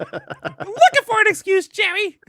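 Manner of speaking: whining Costanza impression, high and loud; George Costanza. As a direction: squeal it like George Costanza to Jerry. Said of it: "pretend you're George Costanza".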